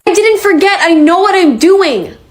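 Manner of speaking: high, insistent protest; defensive. Acted. Defensive answer when questioned about schedule.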